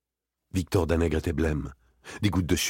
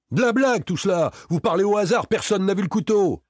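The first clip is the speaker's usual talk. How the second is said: very high, raised-voice outburst; panicked high protest; heated denial; 13 semitones higher, 5 dB louder than usual. A narrator's reading. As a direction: dismiss it loudly, pitched right up. Victor scornfully shouts down accusation.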